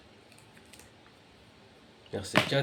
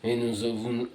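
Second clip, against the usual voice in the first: loud, emphatic verse reading; declaimed scripture reading; 3 semitones higher, 8 dB louder than usual. Real, not acted. Reader proclaiming the annihilation of the mighty, loud declamation. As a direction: proclaim it, punching the key words.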